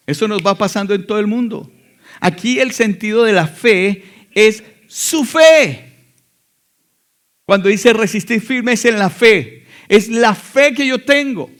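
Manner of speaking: slow, loud, high preacher's build-up; fervent preaching. Acted. Preacher pressing the congregation on faith, fervent loud preaching. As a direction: drive the point home from the pulpit.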